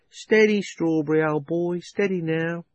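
high, softening calm words; gentle a frightened horse; soothing the horse. A narrator's reading.

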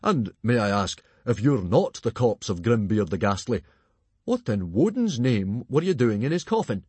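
put-on hearty, blustering voice; hearty chief. A narrator's reading. Stoic questioning the stranger from the coffin, hearty voice. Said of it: "in much of his old hearty manner".

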